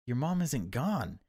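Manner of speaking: a desperate father close to tears; voice nearly sobbing. Acted. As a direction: plead as if about to break down. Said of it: "he nearly sobs".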